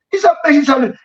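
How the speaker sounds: ordinary delivery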